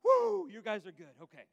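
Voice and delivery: loud, high whoop of relief; hammed-up relieved cheer. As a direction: cheer it out, all the tension gone. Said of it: "like, she's just like".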